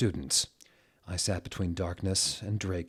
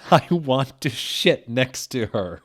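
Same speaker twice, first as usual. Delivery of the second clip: laughing; 4 semitones higher, 6 dB louder than usual. Real, not acted. Reader laughing through line.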